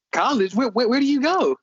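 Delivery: high, disbelieving question; incredulous saleswoman. Retold or imitated. Saleswoman surprised he's in college.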